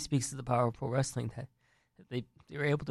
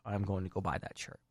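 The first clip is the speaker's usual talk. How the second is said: much softer, lower, muttered to self; a soft, lower promise to yourself; quiet aside; 4 semitones lower, 10 dB quieter than usual. Shown as a demonstration. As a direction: say it half under your breath. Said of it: "repeated as aside".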